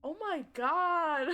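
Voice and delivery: loud, slow, drawn-out wail; anguished cry. Real, not acted. Player wailing at the funeral scene. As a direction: stretch it out in despair. Overwhelmed again.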